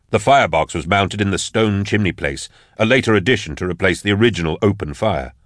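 ordinary delivery

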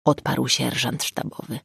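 ordinary delivery, nothing marked

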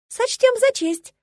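high, slow, twittering chorus; chirping girls. Acted. Girls agreeing to entertain the bride, high chirping. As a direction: trill it like eager debutantes. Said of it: "прощебетали девицы".

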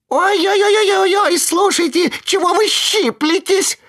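very high, desperate squeal; desperate squeak. A narrator's reading. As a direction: squeal in tiny panic. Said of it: "отчаянно запищал тоненький голосок".

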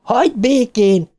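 high-pitched yell at the monster; howled command. A narrator's reading. Beverly yells at the spider, howling. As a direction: cry it out far above narration pitch. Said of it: "üvöltötte a pókra".

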